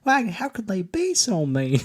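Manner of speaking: high-pitched imitation of a Southern lady; hammed-up high Southern belle voice; sweet Southern lady. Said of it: "there's a sweet Southern lady".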